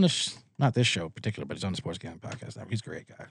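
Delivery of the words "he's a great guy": usual speaking voice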